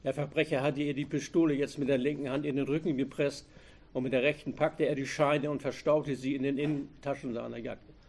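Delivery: plain reading voice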